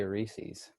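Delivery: Reese's said slowly with a long ee, like "reeseez"; Reese's in a Minnesotan way, unhurried. Shown as a demonstration. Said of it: "We just say".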